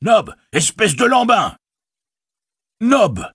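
high loud call Hé, Nob. Acted. Innkeeper calling his servant Nob, loud high call. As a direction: shout for the servant across the inn. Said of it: "répéta-t-il".

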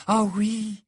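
bright, high happy reply; cheerful. A narrator's reading. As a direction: answer cheerfully. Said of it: "répondit-elle d'un ton joyeux".

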